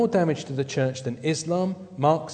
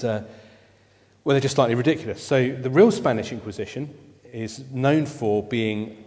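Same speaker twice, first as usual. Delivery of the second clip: ordinary talk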